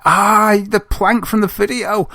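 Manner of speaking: high, slow, amazed realisation; excited recognition. Retold or imitated. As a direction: gasp it out like you just recognised the plank. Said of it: "I was like".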